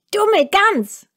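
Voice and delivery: hohe, keifende Zwergenstimme, schnell und gereizt; zeternder Zwerg. A narrator's reading. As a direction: schimpf wie ein ungeduldiger alter Zwerg. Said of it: "rief der Zwerg". (hohe, zeternde Zwergenstimme)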